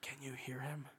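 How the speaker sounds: playful whisper. Real, not acted. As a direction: join in whispering. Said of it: "when I'm whispering".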